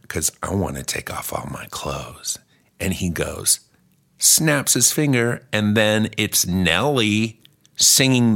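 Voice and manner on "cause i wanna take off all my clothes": low husky whisper; seductive whisper. Acted. Seductive reply in vision.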